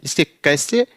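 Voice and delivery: usual speaking voice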